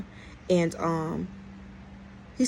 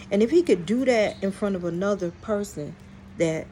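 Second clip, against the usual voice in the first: usual speaking voice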